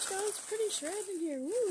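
bright rising thrill over the shred; gushing and loud; excited. Real, not acted. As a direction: squeal with real delight. Excited unboxing, squeal of delight.

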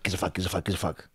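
low, very soft imitated begging; low mumbled pestering. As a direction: repeat it under your breath, low and insistent. Voicing hallucinated people asking him.

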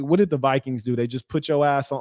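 normal talking voice

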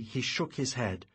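usual speaking voice

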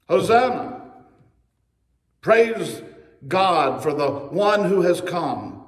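loud, jubilant acclamation; crowd's praise. Acted. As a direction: call out praise like a crowd, opening strong and easing. Acting out crowd praising Jesus.

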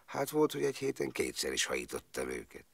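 normal talking voice

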